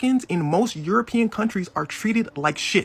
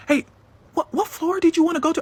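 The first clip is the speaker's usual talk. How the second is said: high, eager and suddenly polite; eager coworker; 9 semitones higher than usual. Retold or imitated. Coworker rushing to help, high and eager. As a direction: scramble to please the angry guest.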